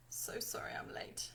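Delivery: breathy whispered apology; soft whispered sorry. Acted. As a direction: whisper it, half joking.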